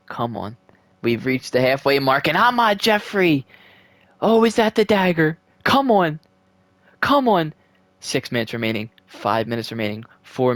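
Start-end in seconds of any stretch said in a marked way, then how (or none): 2.3-7.5 real thrilled cheer at a late goal; excited cheering. Real, not acted. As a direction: cheer the goal like you can't believe it. Late goal in a tight game.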